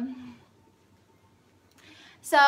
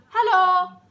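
put-on loud, higher German-accented hello; 5 semitones higher, 7 dB louder than usual. Man greets woman with dog, German accent. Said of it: "the German accent, he said in German".

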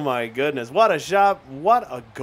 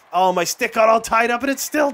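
startled yelp; 7 semitones higher, 4 dB louder than usual. Real, not acted. Stick tangled during hockey play.

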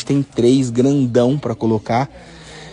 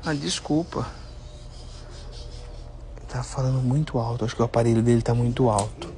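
hushed, lowered voice; library whisper; 2 semitones lower, 10 dB quieter than usual. Real, not acted. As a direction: keep it down so grandpa isn't bothered. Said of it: "Fala mais baixo".

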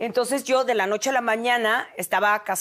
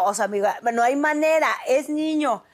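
ordinary talk

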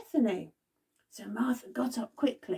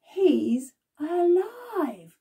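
very slow, higher, louder cry of joy; joyful exclaim; 6 semitones higher, 4 dB louder, much slower than usual. Acted. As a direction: stretch out the joyful news. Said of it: "Mary and Martha are saying".

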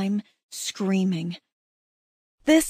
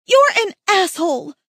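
angry shout; 9 semitones higher, 7 dB louder than usual. Acted. Yelling at her mate.

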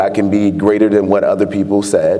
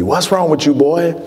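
southern voice; 7 semitones higher than usual. Retold or imitated. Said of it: "in his very southern voice".

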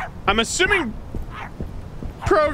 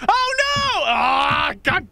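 shocked cry; 4 semitones higher, much slower than usual. Real, not acted. Last tape gone.

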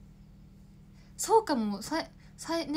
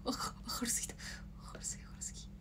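breathy, voiceless whisper of surprise; 12 dB quieter, much faster than usual. Real, not acted. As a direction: hush it as if not to be heard on camera. 撮影中に明るさに驚く. (明るさに驚いてささやき声で)

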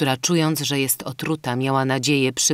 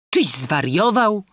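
colonel's retort; 6 semitones higher, 5 dB louder, slower than usual. A narrator's reading. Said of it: "rzekł pułkownik Hulot".

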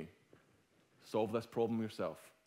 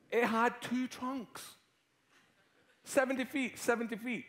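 loud, high, animated retelling; excited storytelling; 12 semitones higher, 7 dB louder than usual. Acted. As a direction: tell it big to the whole room.

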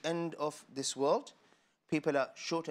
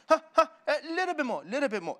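laughing, higher, louder coaxing; teasing host; 11 semitones higher, 7 dB louder than usual. Acted. Host coaxing his guest to eat more, playfully laughing. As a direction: tempt them with one more helping.

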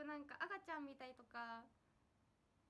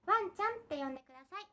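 loud cutesy idol voice; 3 semitones higher, 13 dB louder than usual. Pretend. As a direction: say ワンちゃんって呼んでください sweetly and brightly. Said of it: "自分が出せる最大の可愛い声".

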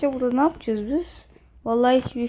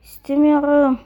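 louder, insistent second no; firm loud refusal; 4 semitones higher, 9 dB louder than usual. Real, not acted. Gamer to the game, refusing the purchase loudly. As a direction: refuse the purchase, pushing harder. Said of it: "Hayır, satın almayacağım".

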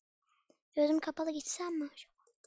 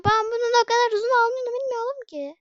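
much higher, louder, fast complaint; exasperated rant; 8 semitones higher, 8 dB louder, faster than usual. Real, not acted. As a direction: vent in disbelief. Frustrated gamer ranting. (hızlı ve tiz sitem)

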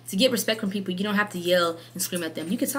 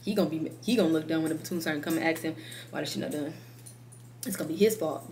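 dismissive slacking soldier; 2 semitones lower, 7 dB quieter than usual. Retold or imitated. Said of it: "because they're like".

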